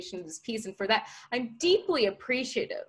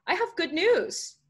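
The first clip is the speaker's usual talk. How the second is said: high, slow, sing-out announcement; eager, raised voice; excited announcement; 9 semitones higher, slower than usual. Acted. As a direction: announce it with glee. Ethan announces good news.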